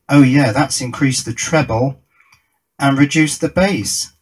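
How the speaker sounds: usual speaking voice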